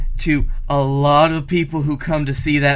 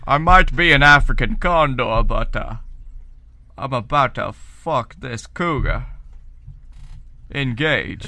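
a hint of stately, measured Patrick Stewart; Patrick Stewart impression. Cohost voices a condor cameo as Patrick Stewart. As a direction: deliver it slow and grand, like a starship captain. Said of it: "Patrick Stewart".

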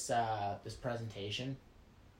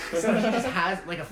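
real laughter, higher and louder; laughing; 6 semitones higher, 11 dB louder than usual. Real, not acted. Friend joking the pilot reads a dictionary, laughing while speaking. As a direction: giggle through the punchline.

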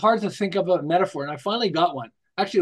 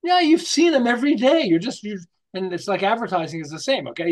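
usual speaking voice

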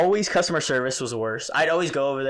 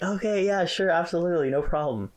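higher-pitched agreeable customer-service voice; accommodating; 7 semitones higher than usual. Retold or imitated. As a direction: play the eager-to-please clerk, pitched up. Retelling own polite reply to customer.

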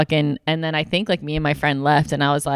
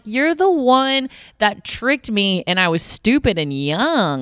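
slow, emphatic, higher put-down; slow pointed retort; 6 semitones higher, slower than usual. Retold or imitated. She rejects her ex's romantic line, slowly and pointedly. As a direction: spell it out slowly to him. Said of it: "I'm like".

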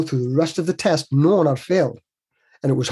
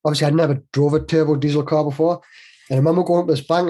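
ordinary talk, nothing marked

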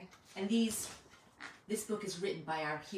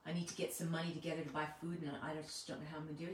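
quiet, fast doubter's voice, softer; doubting inner voice; 3 semitones lower, 6 dB quieter than usual. Pretend. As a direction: mutter the unbeliever's reply under your breath. Voicing the doubter's inner reply.

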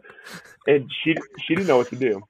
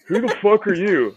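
higher-pitched indignant mock challenge; retold challenge; 7 semitones higher, 3 dB louder than usual. Retelling a run-in with a dude, indignant. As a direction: demand it, pitched up, acting out a confrontation. Retelling a confrontation with passing officer.

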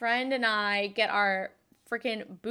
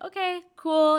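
eager okay; 7 semitones higher, 5 dB louder than usual. Retold or imitated. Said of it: "And I'm like".

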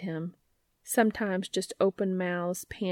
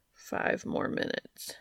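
soft, slow half-whispered grumble; slow drowsy mumble; sleepy protest; 4 dB quieter, slower than usual. Acted. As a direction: mumble it from under the covers. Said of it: "he protested".

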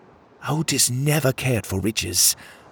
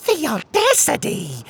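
much higher, outraged cry; indignant exclamation. Acted. Another crab outraged at Pockets, exclaiming. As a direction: throw your claws up in outrage. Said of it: "another crab exclaimed".

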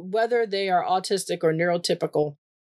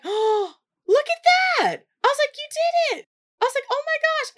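gasp, then squealing far higher praise; thrilled celebration. Retold or imitated. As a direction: celebrate his win at the top of your voice. Child ties shoe on first try.

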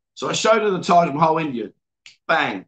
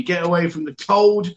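usual speaking voice

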